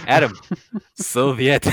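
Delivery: Soviet said loud in a Russian accent. Shown as a demonstration. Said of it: "in a Russian accent".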